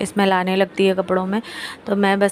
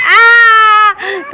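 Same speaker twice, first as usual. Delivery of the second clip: playful shriek; 13 semitones higher, 11 dB louder than usual. Real, not acted. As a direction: let out a sudden high squeal. Cry toward baby.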